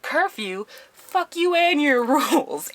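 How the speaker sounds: high-pitched mock defiance with laughter creeping in; defiant protest. Residents rejecting the director's rules, mock outraged. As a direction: act out indignant rule-haters.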